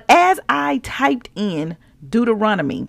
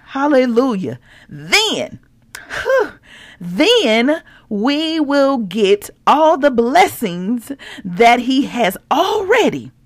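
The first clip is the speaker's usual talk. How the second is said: ordinary delivery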